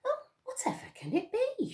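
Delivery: high breathy puzzled lady voice; lightly voiced puzzled Missus Honey; wondering old lady. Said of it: "she wondered".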